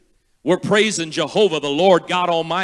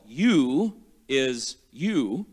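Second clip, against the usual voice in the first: slow teaching; 3 semitones lower, much slower than usual. Shown as a demonstration. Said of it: "The first part of that is hallel".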